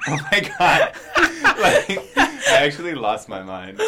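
breaking up with laughter; cracking up mid-sentence; laughing hard. Real, not acted. Laughing retelling a shocking email.

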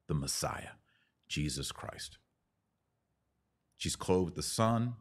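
normal talking voice

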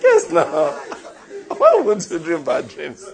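high laugh breaking into the words. Real, not acted. Preacher laughing at his beans joke. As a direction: laugh through the punchline. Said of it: "laughs at own joke".

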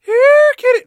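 loud, squealed call held high; piercing kitty call; high-pitched cat call. Acted. Trying takes of a line.

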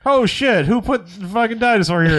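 high, startled curse; startled outburst. Retold or imitated. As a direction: act out shock at finding a dinosaur. Retelling a startled movie character.

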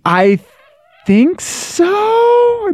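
unsure answer squeaked up high; really high voice. Real, not acted. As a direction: voice shoots up very high. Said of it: "our voices get really high".

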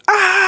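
very high, loud screech that turns into a laugh; parrot scream. Retold or imitated. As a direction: shriek like a startled parrot, then laugh. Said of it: "Einstein goes".

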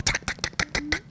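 quick whispery tongue-tapped ticks; tiny voiceless "tuck" noises, very soft; imitated climbing clicks. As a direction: tap out soft spiky climbing sounds. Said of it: "like, climb up".

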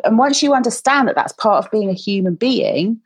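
ordinary talk

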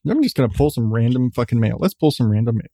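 usual speaking voice

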